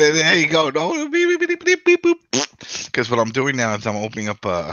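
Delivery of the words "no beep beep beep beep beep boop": very high, loud beep-boop noises; robot beeps. Pretend. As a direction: squeak out a string of robot beeps. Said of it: "with your beep".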